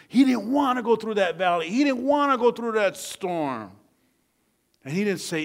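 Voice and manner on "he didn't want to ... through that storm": high, loud, driving sermon delivery; impassioned preaching. Real, not acted. Preacher on David dreading the valley, impassioned. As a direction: drive the repeated phrase home hard.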